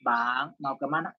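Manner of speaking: low, gentle polite question; soft-spoken asking. Shown as a demonstration. Preacher showing how Bataks rarely ask, low and mild. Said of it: "jarang kalau ngomong itu".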